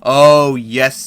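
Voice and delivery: ordinary talk, nothing marked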